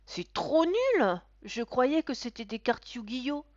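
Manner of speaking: high, slow, loud whine; disappointed boy. A narrator's reading. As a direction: sulk loudly like a let-down child. (enfant déçu, plainte aiguë et forte)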